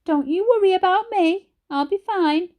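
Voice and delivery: a frail granny putting on a light tone for her family; light, croaky granny. A narrator's reading. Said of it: "with lightness in her voice".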